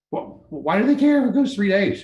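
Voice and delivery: worked-up rhetorical question; agitated. Real, not acted. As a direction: let the annoyance boil over. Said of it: "I'm getting a little agitated".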